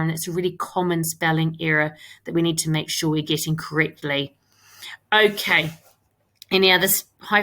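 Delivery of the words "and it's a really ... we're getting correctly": usual speaking voice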